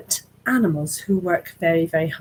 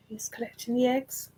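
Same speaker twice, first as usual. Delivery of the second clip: usual speaking voice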